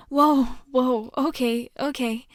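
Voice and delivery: taken aback, then placating; startled calming. Acted. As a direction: settle someone who is screaming. Calming a screaming, panicked stranger.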